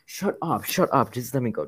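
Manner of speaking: loud, high, exasperated ranting; raging at an unfair game; real gamer rage. Real, not acted. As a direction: protest loudly, pitch jumping up in outrage. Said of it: "I'm so mad".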